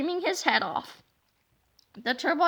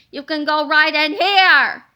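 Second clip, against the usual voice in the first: monster voice; 3 semitones higher, 11 dB louder than usual. Acted. Monster swallows the principal.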